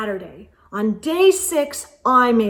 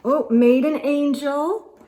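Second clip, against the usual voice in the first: ordinary delivery, nothing marked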